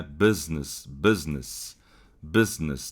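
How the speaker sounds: "business" with the first vowel halfway to E; "business" as "bez-nes", not a pure i; open vowel business. Shown as a demonstration. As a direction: say "business" as "bêz-ness", an open i. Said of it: "Ele é meio E".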